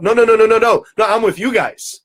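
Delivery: loud, high rattled-off protest; hasty denial. Retold or imitated. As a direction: deny it all at once. Man hastily reassures mocking friends.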